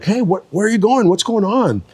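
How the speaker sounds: high-pitched, curious call to someone passing; retold calling out. Calling out to waiter running by.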